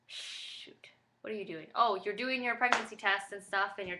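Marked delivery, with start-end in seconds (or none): 0.1-1.7 whispered aside. Real, not acted. Forgets mid-sentence, mutters aside.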